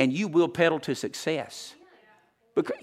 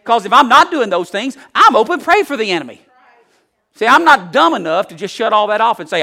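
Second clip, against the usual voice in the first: ordinary talk, nothing marked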